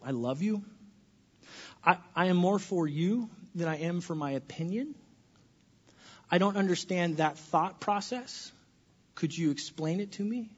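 gentle. Shown as a demonstration. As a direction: speak gently to the world. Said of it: "things that we could say to the world".

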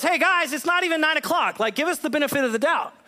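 lightly voiced Peter brushing off the drunk charge; a hint of an exasperated apostle; Peter protesting. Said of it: "And Peter goes".

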